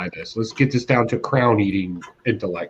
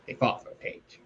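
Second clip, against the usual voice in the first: "They fought for a page" repeated in a soft, toneless whisper; whispered aside; 12 dB quieter than usual. Retold or imitated. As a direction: breathe it as a muttered aside. Mocking the comic's sparse plot.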